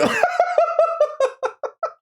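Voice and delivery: high shrieking laugh; real helpless laughter. Real, not acted. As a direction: crack up at the screen. Laughs at the AI reply.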